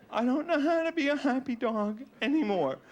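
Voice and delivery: mock sobbing dog lament; sad dog voice. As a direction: play a depressed dog near tears. Depressed dog voiced, crying.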